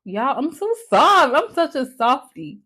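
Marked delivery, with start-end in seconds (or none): none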